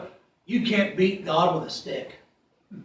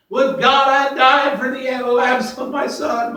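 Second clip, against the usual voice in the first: high, loud mournful cry; loud lament; weeping king; 7 semitones higher, 5 dB louder than usual. Acted. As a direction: wail it like a father in grief.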